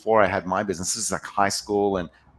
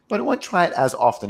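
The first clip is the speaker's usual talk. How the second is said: ordinary talk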